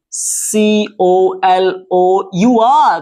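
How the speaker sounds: slow letter-by-letter spelling. Shown as a demonstration. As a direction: spell it out slowly, each letter separate. Teacher spells word out letter by letter. (एक-एक अक्षर धीरे-धीरे बोलते हुए)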